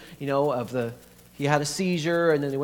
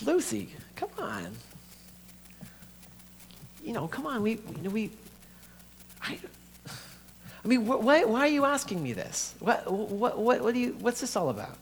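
higher, stammering disbelief; uneasy protest; 7 semitones higher than usual. Acted. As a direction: push back at your sister, pleading and flustered. Dominic balks at sister's question.

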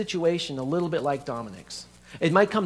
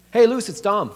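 louder, higher phone hello; phone greeting; 6 semitones higher, 9 dB louder than usual. Acted. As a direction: call out a hello to your sister. Phone greeting to his sister.

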